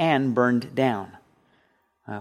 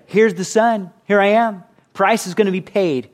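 higher, lifted proclamation; Jesus announcing; 5 semitones higher, 3 dB louder than usual. Acted. Jesus calling his people, higher proclaiming voice. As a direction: announce your arrival with eager urgency.

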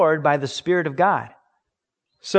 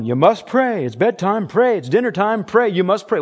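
higher and louder, lightly mimicking a stern parent; nagging parent; 6 semitones higher, 6 dB louder than usual. Parent ordering children to pray, mild mock sternness. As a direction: rattle off orders like a bossy parent.

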